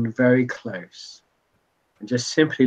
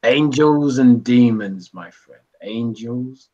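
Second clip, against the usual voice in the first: knowing bartender; slower than usual. Acted. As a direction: start with a breathy "Ah", then stretch each word. Bartender's grave revelation in game.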